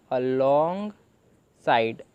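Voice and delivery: alongside stretched very slowly, syllable by syllable; teacher slowly sounding out alongside; drawn-out reading. Shown as a demonstration. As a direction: drag out alongside as you sound it. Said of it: "तो ऐसे ही हमने इसे पूरे को पढ़ा".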